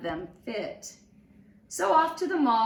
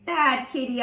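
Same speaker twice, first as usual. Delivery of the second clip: louder scolding shout; 4 semitones higher, 7 dB louder than usual. A narrator's reading. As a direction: kid yelling at the cat. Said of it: "I shouted".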